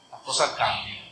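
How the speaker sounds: a quiet, low afterthought; soft echoed repeat. Shown as a demonstration.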